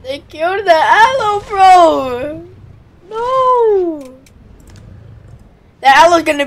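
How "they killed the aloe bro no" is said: high, loud wail of loss; anguished cry. Real, not acted. Player crying out as the aloe dies. Mourning the killed companion.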